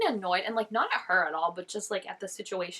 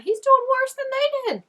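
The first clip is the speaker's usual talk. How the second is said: outraged shout; 10 dB louder than usual. Real, not acted. Outraged at the doctor.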